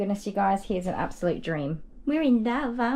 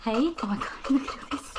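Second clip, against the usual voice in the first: normal talking voice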